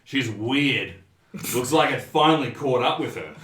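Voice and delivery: loud, scornful barfly; loud-mouthed drinker passing judgment; large drunken man. Acted. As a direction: announce your verdict loudly from the bar. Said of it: "the large man who was at the bar".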